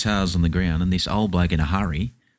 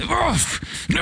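mock strain. Lifting boxes into the car, mock strained shout. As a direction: heave and groan it.

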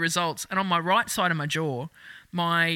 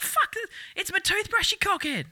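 mock outraged retort; 12 semitones higher than usual. Retort at security, high.

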